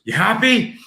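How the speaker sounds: high, loud mock yell; imitated pissed-off child. Angry son to dad after punching, mimicked yell. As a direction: throw it at dad in a child's fury. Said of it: "pissed off at me".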